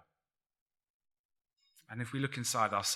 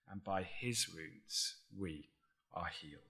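hushed; 4 semitones lower, 8 dB quieter than usual. Real, not acted. Scripture close, slowed and hushed.

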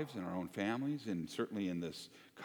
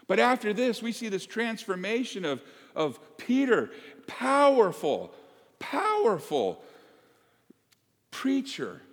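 fervent preaching; 9 semitones higher, 5 dB louder than usual. Real, not acted. Pastor praising Peter to the congregation, high and loud. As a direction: declare Peter's power.

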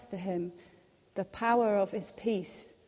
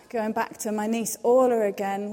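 ordinary talk, nothing marked

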